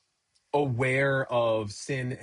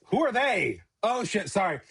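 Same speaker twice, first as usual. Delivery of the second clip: startled listeners; 6 semitones higher than usual. Retold or imitated. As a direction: blurt it in alarm then apologise. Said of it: "and they're like".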